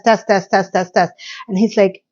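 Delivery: ordinary delivery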